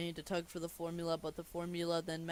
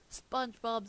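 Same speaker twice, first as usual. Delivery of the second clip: higher, sharp name call; annoyed scolding; 6 semitones higher, 4 dB louder than usual. Acted. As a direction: cut off the laughter with the name. Squidward scolds the laughing SpongeBob.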